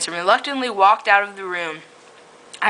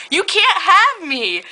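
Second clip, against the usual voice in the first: laughing; 11 semitones higher, slower than usual. Real, not acted. Reader laughs through line.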